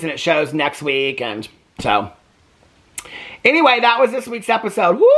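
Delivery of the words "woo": high, drawn-out whoop; long exhaling "woo"; relieved "woo". Real, not acted. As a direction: let out a big phew after a long recap. Relieved exclamation after long recap.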